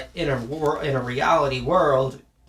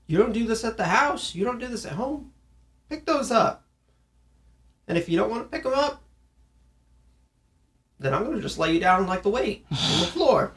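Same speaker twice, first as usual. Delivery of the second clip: put-on much higher, scolding a messy kid; mock scolding parent; 7 semitones higher than usual. Parent to kid throwing weights, higher scolding tone. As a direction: tell the kid off sharply.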